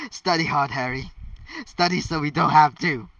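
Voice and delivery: loud, pushy encouragement; urging loudly. Acted. Ron loudly urging Harry to study for all of them.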